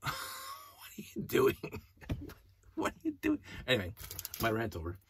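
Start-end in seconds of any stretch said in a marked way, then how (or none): 0.0-1.8 whispered, laughing "What are you doing?"; laughing disbelief. Real, not acted. Fan mocking the movie, laughing in disbelief. 2.7-3.4 much higher, soft "What are you doing?"; squeaky incredulous repeat. Real, not acted. Fan mocking the movie, a squeaky repeat.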